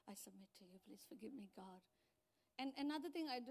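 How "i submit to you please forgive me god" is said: much softer, lower, humble prayer; hushed prayer. Retold or imitated. Pastor showing the church her prayer of surrender, hushed. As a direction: murmur it to God like a confession.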